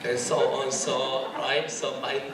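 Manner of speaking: slow, casual American English; laid-back American accent, drawn out. Shown as a demonstration. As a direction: pose as a Korean-American, unhurried. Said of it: "미국 사람 같다".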